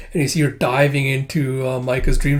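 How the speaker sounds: ordinary delivery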